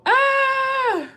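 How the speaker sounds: long, high "ah"; real overwhelmed wail; emotional squeal. Real, not acted. Reader moved by a kind gift, high emotional wail.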